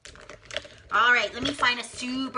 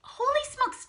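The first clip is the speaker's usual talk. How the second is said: much higher, playful astonishment; amazed. Real, not acted. Teacher amazed at the guesses, playful astonishment. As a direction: pop out a delighted "Holy smokes".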